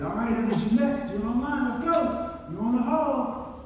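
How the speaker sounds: high, loud call down the hallway. Retold or imitated. Official calling the fighter to walk out, loud call. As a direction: summon the fighter to the cage.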